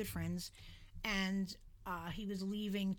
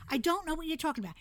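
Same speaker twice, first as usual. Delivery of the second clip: higher and louder, fast exasperated questioning; 9 semitones higher, 8 dB louder than usual. Retold or imitated. Narrator chasing the old lady down the path, exasperated and loud. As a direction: demand an answer, fed up.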